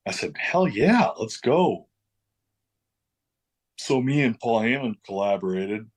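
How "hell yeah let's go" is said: excited. Retold or imitated. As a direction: jump at the offer. Retold eager agreement.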